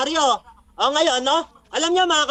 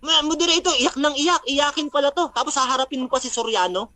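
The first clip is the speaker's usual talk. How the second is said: normal talking voice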